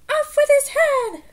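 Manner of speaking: high, slow, furious royal shriek; Queen's cry. A narrator's reading. Queen shrieking at the cardplayers, high and slow. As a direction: bellow it like a tyrant queen. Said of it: "she cried".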